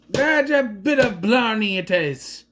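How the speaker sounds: slow hammed-up Irish brogue; playful fake Irish lilt; mock Irish accent. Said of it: "I can do that".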